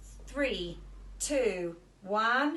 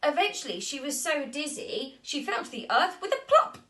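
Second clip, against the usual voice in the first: usual speaking voice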